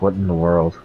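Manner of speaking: ordinary talk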